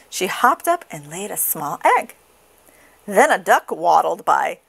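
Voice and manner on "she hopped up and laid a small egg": plain reading voice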